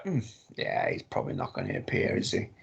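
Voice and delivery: low, hushed musing to oneself; doubtful muttering. Retold or imitated. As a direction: mutter it quickly under the breath, unconvinced. Retelling own doubtful thought.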